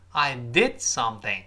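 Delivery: slow model line, DID stressed; "did" emphasized, then falling through "something". Shown as a demonstration. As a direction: take it slowly and lean on "did". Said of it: "did一定要重读".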